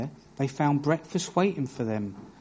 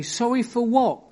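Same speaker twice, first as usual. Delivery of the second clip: higher, pressing mock-mum challenge; imitating demanding mum; 8 semitones higher, 4 dB louder than usual. Mum pressing her son after a careless apology, mock demanding. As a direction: play the mother who won't accept a lazy apology. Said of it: "And she'd say".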